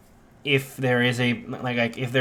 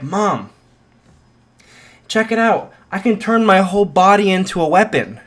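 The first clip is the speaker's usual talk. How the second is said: high, loud, eager showing off; excited son; 6 semitones higher, 6 dB louder than usual. Acted. Son showing his mom his power, excited. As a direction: proudly announce it at high volume. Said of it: "Izuku is adequately excited".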